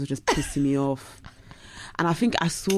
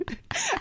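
squeaky laugh; 6 dB quieter than usual. Real, not acted. Laughing crying.

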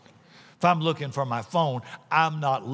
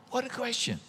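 soft aside; 9 semitones higher, 7 dB quieter than usual. Real, not acted. Same remark repeated softly.

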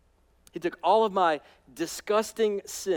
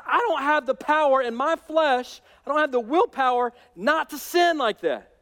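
voicing Paul; 9 semitones higher, 6 dB louder than usual. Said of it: "But Paul says".

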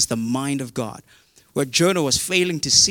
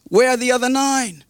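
incredulous demand; 10 semitones higher, 5 dB louder than usual. Retold or imitated. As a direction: demand it in acted astonishment. Acting Jesus challenging the leper.

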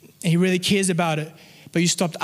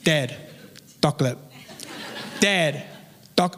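lightly imitated little boy, low and insistent; short repeated pleas in baby talk, pauses between; toddler son; 3 semitones lower, 3 dB quieter than usual. Son pestering his sleeping dad, lightly imitated toddler. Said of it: "that's how he sounds".

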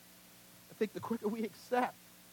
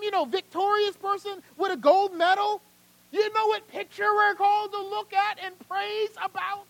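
high, loud, driving sermon delivery; impassioned preaching; 13 semitones higher, 14 dB louder, slower than usual. Real, not acted. Preacher pleading for suffering believers to the congregation, impassioned. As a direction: preach it hard, pitched up and forceful.

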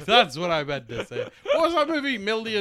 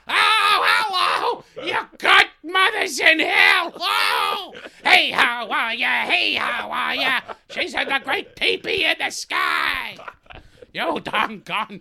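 shrieking, much higher, loud squawk; Gilbert Gottfried; 10 semitones higher, 7 dB louder than usual. Retold or imitated. Friend answers the ghost story doing a Gilbert Gottfried screech. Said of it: "It's Gilbert Gottfried".

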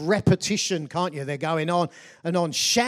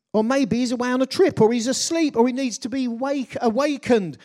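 louder, higher mocking taunt; quick, raised jeering as Elijah; scoffing; 6 semitones higher, 5 dB louder than usual. A narrator's reading. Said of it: "he scoffed".

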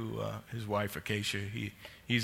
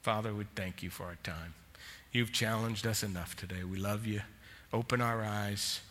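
closing prayer. Real, not acted. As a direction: pray it gently over the congregation.

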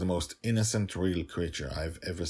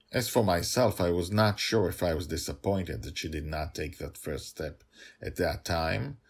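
usual speaking voice

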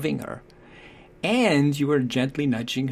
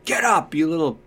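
angry parent; 4 semitones higher, 4 dB louder than usual. Acted. Harsh parent, power without love.

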